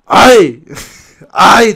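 loud mock cry; mock moan. The lady crying out in bed, loud mock moan. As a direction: belt out a hammed-up moan. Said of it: "Decía".

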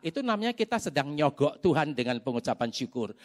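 usual speaking voice

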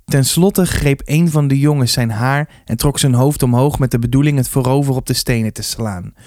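ordinary delivery, nothing marked

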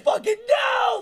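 high, loud imitated terror yell; hammed-up crash panic; mock panicked yell. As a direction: scream it like you're nosediving. Imitating screaming passengers.

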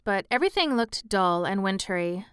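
usual speaking voice

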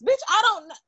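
high-pitched, hammed-up exasperated comeback; mock exasperation. Acted comeback to random question.

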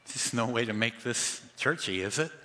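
normal talking voice

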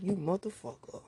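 mock angry grumble under the breath; tight-mouthed mutter. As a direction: hiss it at the partner half-awake. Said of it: "look at him with a tight-ass mouth".

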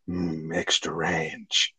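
low, drawn-out relish; savouring. Retold or imitated. Friend retells Dave finding extra ranch sauce, savouring. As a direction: draw it out slowly and softly, like tasting it.

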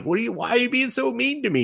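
high, fast whine; whiny hurt voice. Retold or imitated. Son hurt by the brush-off, fast whiny plea. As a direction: whimper it out like a wounded kid.

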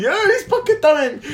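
put-on high, loud childish whine; little kid voice. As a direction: squeak it like a bratty kid. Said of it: "e quindi". (voce acuta da bambino capriccioso)

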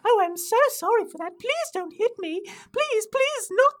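high, frantic British falsetto; hammed-up British panic, cringing apology; pleading British intern. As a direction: beg for forgiveness in an exaggerated British squeak. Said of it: "did I lose my accent?".

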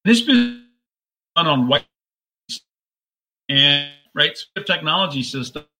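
choppy, glitchy call audio; breaking up like a bad connection. Real, not acted. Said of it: "the audio is breaking up a bit".

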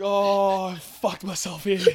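loud, high dismayed wail; dismayed exclamation. Retold or imitated. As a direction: wail that you've ruined yourself. Retold drunken realisation continues.

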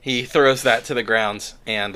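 normal talking voice

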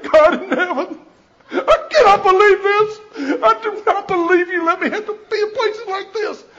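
high, laughing, half-crying mock lament; laughing mock wail. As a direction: wail to heaven, but laughing through it. Retold shock in the shower.

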